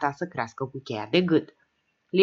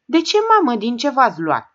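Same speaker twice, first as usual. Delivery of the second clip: anxious; 7 semitones higher, 5 dB louder than usual. A narrator's reading. Frightened mother asks.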